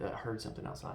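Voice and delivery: ordinary talk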